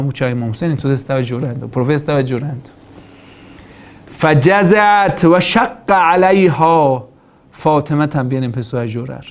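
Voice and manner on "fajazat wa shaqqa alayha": slow Arabic recitation. Acted. Preacher recites Arabic hadith text.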